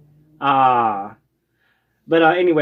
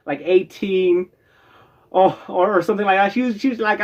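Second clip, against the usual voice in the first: ordinary talk, nothing marked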